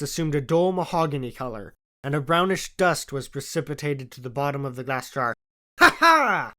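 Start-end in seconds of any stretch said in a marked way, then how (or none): 5.8-6.5 high, loud gleeful laugh; delighted laugh. A narrator's reading. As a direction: laugh like a child with a new toy. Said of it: "he cried".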